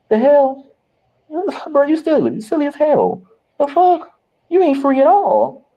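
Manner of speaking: high-pitched, exasperated teasing; squealing at a friend who hides from his own cousin; incredulous high mock scolding. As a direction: pitch way up and scold him like he's being silly. Mocking friend hiding from cousin.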